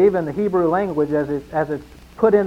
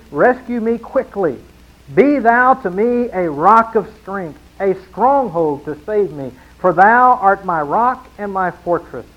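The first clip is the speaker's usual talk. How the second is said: preaching psalm reading; 2 semitones higher, 5 dB louder than usual. Acted. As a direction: proclaim the psalm like a plea to God. Reads psalm with rising force.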